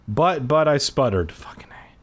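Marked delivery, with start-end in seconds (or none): 1.3-2.0 whispered aside. Real, not acted. Reader drops out of the story to whisper a curse, hushed whisper. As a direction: breathe it out under your breath. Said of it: "whispered aside".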